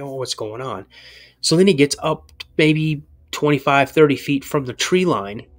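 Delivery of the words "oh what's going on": soft, hushed wondering; wondering to himself. Retold or imitated. Boy watching dad from the stand, hushed wondering. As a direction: murmur it under your breath.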